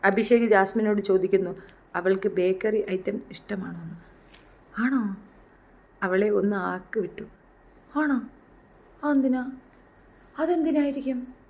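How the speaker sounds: soft, low, halting narration; quiet, paused mock-mystery delivery; hushed mock suspense. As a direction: tell it as a hushed secret. Mock suspense over trivial question.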